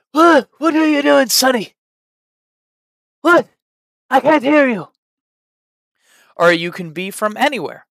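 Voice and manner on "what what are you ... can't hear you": put-on much higher, much louder old man calling out; a deaf grandpa shouting at Sonny. Grandpa who can't hear Sonny, loud high old man voice. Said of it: "be older".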